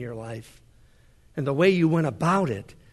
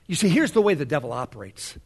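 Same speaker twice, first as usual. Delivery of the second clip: ordinary talk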